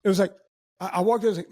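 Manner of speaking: normal talking voice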